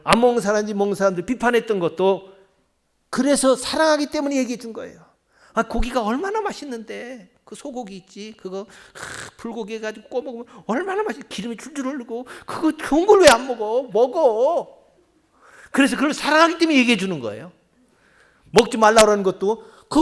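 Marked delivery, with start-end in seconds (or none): 5.5-14.8 eager urging. Retold or imitated. Urging others to eat beef.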